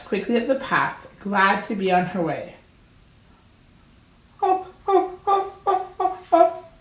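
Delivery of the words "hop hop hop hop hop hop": high squealing mock scream. A narrator's reading. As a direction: wail the words like a little animal. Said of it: "screamed the fox".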